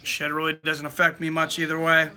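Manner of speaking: ordinary talk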